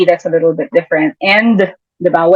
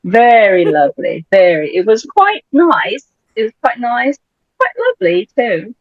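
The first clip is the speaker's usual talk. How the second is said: mock British accent; 3 semitones higher than usual. Host praising the episode, playful British accent. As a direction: savour each word in pretend British. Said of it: "Paano ba British accent?".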